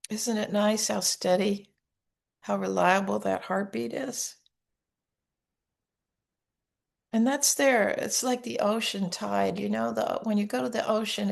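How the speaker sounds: soft, unhurried meditation guidance; guided meditation. Acted. Teacher to listeners with hands on hearts, soft guided meditation. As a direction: guide them gently into calm and safety.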